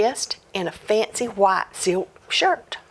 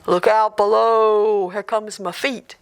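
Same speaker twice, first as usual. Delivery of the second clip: ghost voice from the chimney; 4 dB louder than usual. Acted. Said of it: "this voice comes down the chimney".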